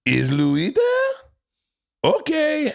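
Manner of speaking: put-on slow, innocent little girl on the phone; little daughter's prank call. Young daughter calling a stranger, innocent and slow. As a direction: play a ten-year-old making a timid call. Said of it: "just calls a number and goes".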